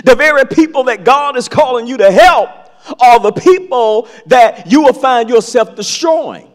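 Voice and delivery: ordinary delivery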